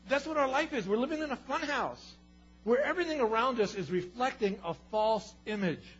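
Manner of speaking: loud, high sermon peak; preaching at full force. Acted. Preacher tells the church life is a fun house, loud. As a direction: drive the point home hard.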